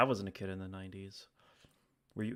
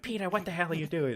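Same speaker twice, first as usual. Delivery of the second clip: higher, louder nagging wife; mock-shrill scolding as Lois; Lois impression; 9 semitones higher, 6 dB louder than usual. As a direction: Lois yelling at her husband Peter. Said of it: "Lois is like".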